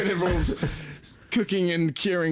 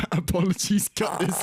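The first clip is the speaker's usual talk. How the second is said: normal talking voice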